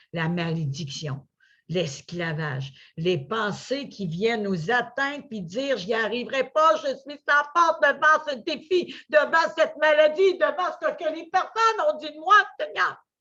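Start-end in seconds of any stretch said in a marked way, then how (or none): 5.8-13.0 despairing plea. Acted. Voicing discouraged thoughts.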